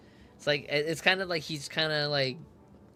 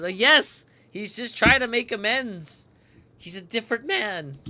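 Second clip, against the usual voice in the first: excited; 9 semitones higher, 10 dB louder than usual. Real, not acted. Cheering character's change.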